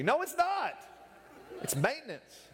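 sudden high-pitched contradiction; mock-exasperated high outburst; exasperated rebuttal. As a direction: jump up high to knock down the excuse. Rebutting the acted excuse.

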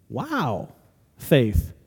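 low, soft, deflated wow; underwhelming wow. Acted. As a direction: say it as if barely impressed. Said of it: "what if we're more like the".

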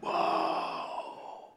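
breathless whispered whoa; whispered astonished whoa; awed whoa. Retold or imitated. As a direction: gasp it out in wonder. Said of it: "And you're like".

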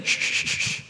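string of soft, unvoiced shushes; imitated shushing. People silencing someone crying for help, soft shushing. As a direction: hush someone insistently.

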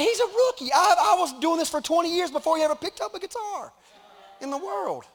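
high, loud put-on voice of petty envy; mock jealous rant. Pastor mocking envy of his son's Facebook fame, high and loud. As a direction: play the jealous veteran for laughs. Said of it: "I'm like".

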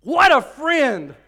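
high, loud and slow; awed exclamation. Real, not acted. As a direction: savour the words in wonder. Preacher marvels at Paul.

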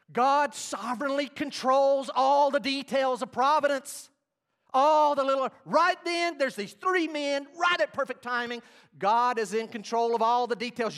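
high, loud pulpit proclamation; full-force preaching. Real, not acted. Preacher declaring God's providence to congregation, full-force preaching.

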